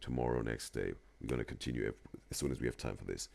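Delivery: soft, calming partner voice winding down; quiet bedtime suggestion. Pretend. Partner soothing before bed, soft and calm. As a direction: settle them down softly, no rush. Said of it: "Maybe say".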